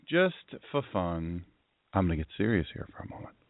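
low, soft serious register. Shown as a demonstration. Host shows his radio listeners his serious voice, low. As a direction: drop down into a hushed, grave tone. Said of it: "that's my serious voice".

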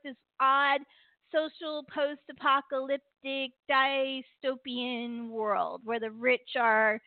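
ordinary talk

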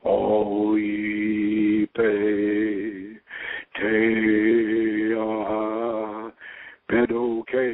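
intoned Enochian god-names with ritual weight; Enochian invocation. Real, not acted. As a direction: call each Enochian name out like a spell. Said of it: "do it in Enochian".

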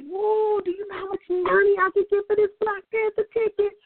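high, loud gleeful whoop; greedy old self. Retold or imitated. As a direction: crow over the windfall like a schemer. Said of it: "the old [name] would have been like".

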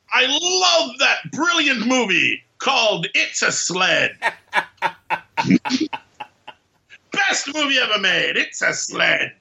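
high, slow mock-gushing fan; sarcastic gushing. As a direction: gush over it with heavy sarcasm.

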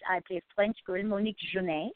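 put-on French girl's accent; French-accented English, at an easy pace. Shown as a demonstration. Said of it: "took, like, this with a French accent".

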